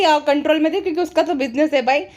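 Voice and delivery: usual speaking voice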